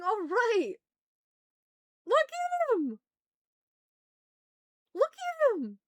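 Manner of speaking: high, loud delighted squeal; excited squeal. Real, not acted. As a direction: squeal over something cute. Squealing over a cute character.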